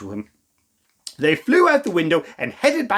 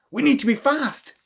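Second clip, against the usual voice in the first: urgent; 7 semitones higher than usual. Acted. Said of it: "said Rose".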